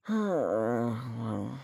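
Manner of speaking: long, low sigh; weary sigh. Acted. Scientist character between dismissals, low sigh.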